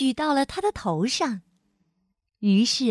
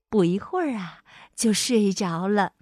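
ordinary delivery, nothing marked

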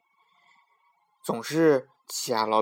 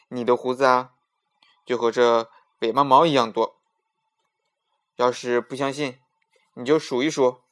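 confident, slightly louder Afanti; bold Afanti; 7 dB louder than usual. Acted. Afanti to the king, teasing with cheeky confidence. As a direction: answer the king cheekily, unafraid.

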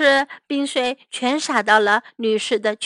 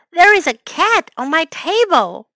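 higher, louder astonished voice; crying out; 6 semitones higher, 6 dB louder than usual. A narrator's reading. Walrus spots a cat on the table, crying out. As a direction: exclaim it in indignant surprise. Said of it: "cried the walrus".

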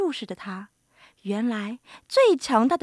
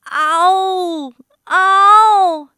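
loud, high drawn-out roar; storybook lion's "ao" roar; 6 semitones higher, 12 dB louder than usual. A narrator's reading. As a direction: leap out roaring to scare someone. Lion leaps out roaring to scare.

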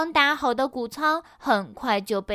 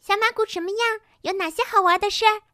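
high, fast, eager questions; curious; 9 semitones higher, 4 dB louder, faster than usual. A narrator's reading. Newly made Pinky asks about Ponyville, curious and high-pitched. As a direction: pepper them with questions like an excited newcomer. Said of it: "新出现的碧琪很好奇".

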